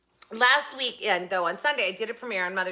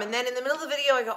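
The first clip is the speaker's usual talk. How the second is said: usual speaking voice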